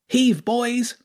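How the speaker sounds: high-pitched polecat rallying cry. A narrator's reading. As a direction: call the gang to action in a high voice. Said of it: "he cried".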